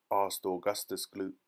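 plain reading voice

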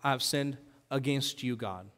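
soft, falling low confession; a soft, humble admission of sin; hushed confession. Retold or imitated. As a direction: confess it quietly, head bowed. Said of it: "he said".

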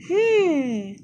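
long hum, high and falling; pondering hum. A narrator's reading. Toni considers a remedy, pondering hum. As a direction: hum loudly while thinking it over. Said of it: "überlegte Toni".

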